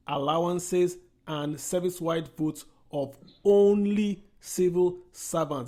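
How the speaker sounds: measured, emphatic pace with weight on each item; slow, deliberate. Real, not acted. Panelist driving home where the oil money goes, slowly and deliberately. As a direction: spell it out slowly, phrase by phrase. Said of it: "let me say it so slowly".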